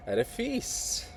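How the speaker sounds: sly, high and slow, drawn out. A narrator's reading. Shevchenko slyly revealing his plan to Olha, high and drawn out. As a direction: dangle the word feast like bait, slowly.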